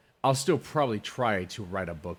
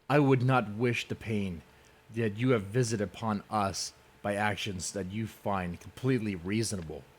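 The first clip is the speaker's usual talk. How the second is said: ordinary talk, nothing marked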